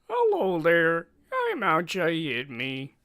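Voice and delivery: slow, high goofy voice; loud cartoonish put-on voice; funny voice. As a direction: draw it out in a silly high voice. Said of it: "Making the funny voices".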